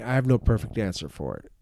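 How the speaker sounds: ordinary talk, nothing marked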